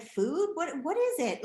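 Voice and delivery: exasperated disbelief; mock bafflement. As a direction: act like food is a mystery. Baffled mother.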